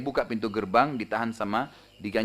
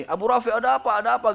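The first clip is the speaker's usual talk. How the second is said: high-pitched imitated calling out; mock the alarmed cry of a whole crowd; retold shout; 10 semitones higher, 4 dB louder than usual. Intruder pretends a crowd has come to Abu Rafi, high retold shout. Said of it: "Dia teriak yang ketiga kali".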